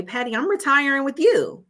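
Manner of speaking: high-pitched retold declaration to a coworker; playful announcement. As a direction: announce it high and sure, falling at the end.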